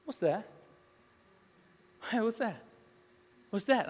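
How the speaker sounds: lightly voiced small child; short, insistent kid questions; little boy. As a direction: imitate a toddler asking over and over. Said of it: "if he said it one time".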